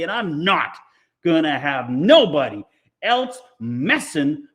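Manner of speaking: high, loud, fast rant; furious tirade. Acted. Amanda rants at Mars Bar while kicking him, high loud tirade. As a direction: unload on him in one breathless rush.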